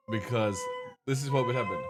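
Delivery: ordinary delivery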